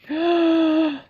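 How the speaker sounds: a hammed-up high gasp; dramatic gasp. The reader gasps theatrically at the cliffhanger. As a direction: gasp for mock suspense. Dramatic reaction after chapter end.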